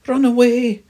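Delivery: very high, loud mouse shriek; crying out. Acted. Mouse warning friends of the owl, shrieking. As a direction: yell the alarm at full pitch. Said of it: "cries the mouse".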